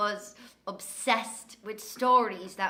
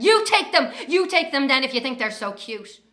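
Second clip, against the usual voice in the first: loud, higher angry outburst; loud exasperated shout; imagined yell; 4 semitones higher, 9 dB louder than usual. Retold or imitated. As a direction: snap at the gushing strangers. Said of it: "stopping herself from yelling".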